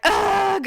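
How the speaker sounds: high imitated groan of dread; retold groan. Daughter hearing she needs a flu shot, groaning. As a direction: groan it out in mock protest.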